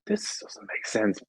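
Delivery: slow, breathless whisper to himself; a slow whispered aside of disbelief. Retold or imitated. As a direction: whisper it like an inner thought. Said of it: "you're kinda going".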